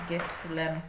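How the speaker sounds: normal talking voice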